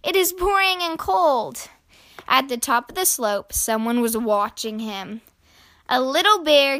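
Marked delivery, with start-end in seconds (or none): 0.0-1.7 put-on high, childlike grumble; lightly acted upset child; mild sniffly complaint. A narrator's reading. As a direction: complain like a lonely little one. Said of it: "sniffled Flop".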